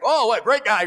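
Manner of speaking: loud high gushing; delighted praise. Retold or imitated. Jesus praising the centurion, loud delighted gush. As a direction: gush about him warmly.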